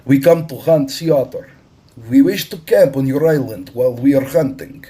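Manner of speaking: bold Russian captain. A narrator's reading. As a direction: announce it like a man who owns the harbor.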